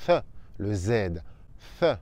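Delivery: th with the tongue between the teeth; Spanish z. Shown as a demonstration. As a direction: lisp th like the Spanish Z. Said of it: "la langue entre les dents".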